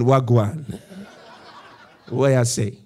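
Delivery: mock Jamaican-style patois accent; playful switch into a patois accent; put-on changed accent. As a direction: lightly fake a different accent. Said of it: "He changed his accent".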